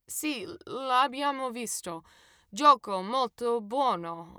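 slow, careful learner's Italian; picking each Italian word with effort; halting Italian. A narrator's reading. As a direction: narrator answers in slow, careful beginner Italian. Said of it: "I replied as best I could".

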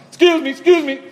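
loud high polite excuse me, excuse me; exaggerated courteous honk. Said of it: "you're like".

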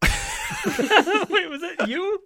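high, laughing disbelief; laughing question. Real, not acted. Actor breaking character to castmate, laughing. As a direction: crack up while asking.

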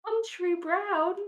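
incredulous. Real, not acted. Incredulous echo of the phrase.

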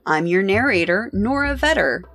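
ordinary talk, nothing marked

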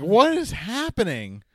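loud, high, startled what-is-happening; bursting in on the chaos, rattled; mock alarmed shout. Co-host quoting Torrio walking in on the beating, loud mock alarm. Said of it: "Torrio's like".

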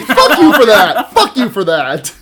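loud, drawn-out laughing retort; laughing mock outrage. Podcaster cursing a friend's joke, laughing mock outrage. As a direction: shout it through laughter.

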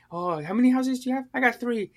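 high-pitched put-on voice; imitated chatty homeowner. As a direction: pitch it up as a mimicked character. Voices a chatty character in film.

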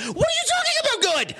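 high and fast, cutting herself off; a quick high yelp of disbelief; sudden disbelief. Acted. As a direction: snap into shocked disbelief.

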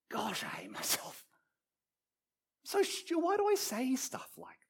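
hushed, whispery self-scolding that rises high; quiet self-reproach. Acted. Guilty person alone at home thinks it, hushed self-reproach. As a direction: berate yourself privately in a hush. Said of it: "in the quietness of your heart".

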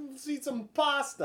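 high, slow hammed-up Italian voice; mock Italian. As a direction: play a cartoon Italian about pasta. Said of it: "I'm like".

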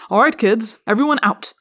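loud curt order; snapped. A narrator's reading. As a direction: bark the kids out. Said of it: "Chapman snapped".

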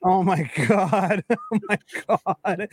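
disbelieving laugh through the words; laughing in disbelief. Real, not acted. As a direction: crack up while saying it. Stunned by the cooler story.

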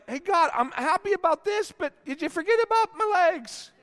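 high-pitched, put-on nagging at God; mock complaint. Worshiper asking God about unhealed legs, mock complaining. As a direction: pitch it up as an ungrateful pray-er.

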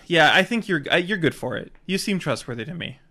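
ordinary talk, nothing marked

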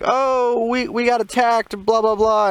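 high, loud pleading stranger voice; lying stranger. Retold or imitated. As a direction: play the lying victim begging for help. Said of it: "there was this dude that was like".

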